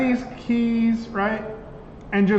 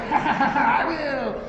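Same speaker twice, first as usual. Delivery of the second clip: higher mock evil laugh with a gloating line; villain toy laugh; 5 semitones higher than usual. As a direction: ham up Skeletor's cackle. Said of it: "and he's like".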